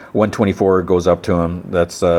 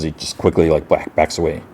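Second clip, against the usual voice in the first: plain reading voice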